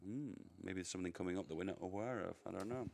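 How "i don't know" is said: normal talking voice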